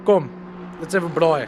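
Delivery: put-on a South African inviting friends round, Afrikaans accent. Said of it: "my Afrikaans accent".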